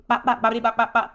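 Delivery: loud, staccato bop-bop-bop; mock someone's nonsense with popping syllables; punchy nonsense sounds to shut someone up; cutting-off noises. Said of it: "That's how I do my son".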